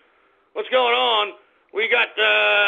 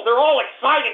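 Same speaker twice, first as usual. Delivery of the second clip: ordinary talk, nothing marked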